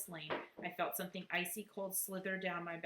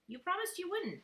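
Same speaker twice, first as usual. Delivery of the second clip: much higher whiny complaint; whining; 13 semitones higher, 4 dB louder than usual. Acted. Small boy to bully girl, high whine. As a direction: whine it like a wronged kid. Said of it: "Daniel whined".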